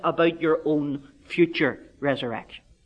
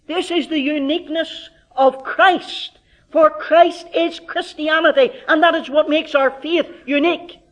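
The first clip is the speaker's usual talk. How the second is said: higher, ringing pulpit proclamation; preaching declaration; 10 semitones higher, 5 dB louder than usual. Acted. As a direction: proclaim it as the heart of the faith. Preaching climax.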